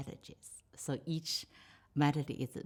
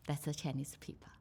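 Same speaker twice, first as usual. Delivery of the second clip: whispered aside; 6 dB quieter, faster than usual. Real, not acted. Aside after the boat-race description.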